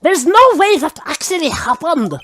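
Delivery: bad German accent. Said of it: "Or in German".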